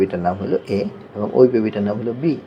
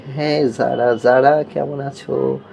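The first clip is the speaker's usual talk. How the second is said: higher, slow, drawn-out sing-song greeting; mother on pretend call; 5 semitones higher, much slower than usual. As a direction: coo to a child over a make-believe phone. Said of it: "আমি মা বলছি". (মায়ের মতো টেনে টেনে সুর করে বলা)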